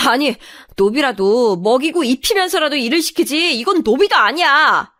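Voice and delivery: indignant tirade, climbing at the end; shrill-tempered ranting. Acted. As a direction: rail at them, fed up. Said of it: "제가 소리를 바락거리면서 지르자".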